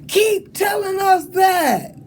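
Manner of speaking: high, loud and drawn-out call; yelled from afar. Retold or imitated. A passing guy shouts encouragement back from a distance, loud. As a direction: shout it across the parking lot. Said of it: "he yells out".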